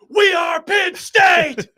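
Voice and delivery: high, drawn-out shouted chant; full-throated stadium yell, stretched out; fan yelling. Retold or imitated. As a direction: bellow it like a rowdy fan running onto the field. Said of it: "just yelling".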